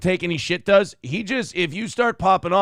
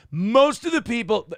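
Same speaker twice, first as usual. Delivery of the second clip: ordinary delivery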